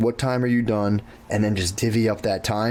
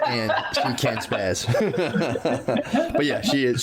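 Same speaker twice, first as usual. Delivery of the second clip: laughing. Real, not acted. Laughing about his mom.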